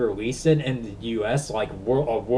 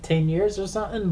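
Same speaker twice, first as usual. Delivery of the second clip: confused; 6 semitones higher than usual. Retold or imitated. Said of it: "I'm like".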